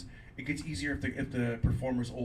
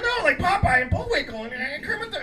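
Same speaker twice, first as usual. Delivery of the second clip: high, loud, frantic backpedal; panicked backpedal; 9 dB louder than usual. Retold or imitated. Comic backpedals to a booing crowd, panicked and high. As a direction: scramble to fix it, voice jumping up.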